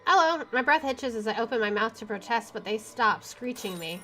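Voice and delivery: normal talking voice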